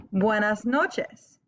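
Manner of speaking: "Buenas noches" drawn out slowly; slow model to repeat. Shown as a demonstration. Teacher saying "Buenas noches" for students to repeat. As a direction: give it slowly so they echo it. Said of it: "I'm gonna say it, and then I want you to repeat it".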